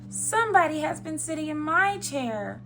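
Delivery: wee little high bear voice; Baby Bear. Acted. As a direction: complain in a small cub voice. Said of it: "said Baby Bear in his wee little voice".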